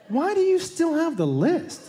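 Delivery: slow, laughing disbelief; incredulous laughing. Retold or imitated. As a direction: ask in amused disbelief. Incredulous question.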